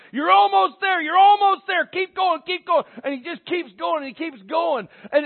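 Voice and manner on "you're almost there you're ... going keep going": high, loud, urgent coach urging a player on; yelling coach. Retold or imitated. Coach to exhausted player on the football field, yelling encouragement. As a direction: shout it like a coach driving a player on. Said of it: "The coach is there yelling at him".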